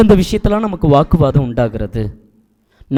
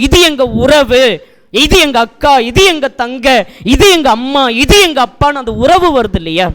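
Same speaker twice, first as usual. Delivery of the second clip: high, forceful sermon delivery; raised, ringing pulpit proclamation; impassioned preaching; 9 semitones higher, 4 dB louder than usual. Real, not acted. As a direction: preach it at full voice, driving the key words home. Preacher builds to full force.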